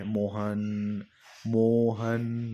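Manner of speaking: prolonged pluta vowel call; drawn-out calling. Shown as a demonstration. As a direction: stretch मोहन long like calling someone far away. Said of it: "जैसे हम किसी को दूर से चिल्लाते हैं ना".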